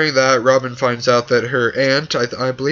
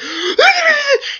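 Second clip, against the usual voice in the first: high gasp with a laugh; high mock gasp; 4 dB louder than usual. Mocking Marshall's spirit taking over, a high gasp. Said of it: "He's like".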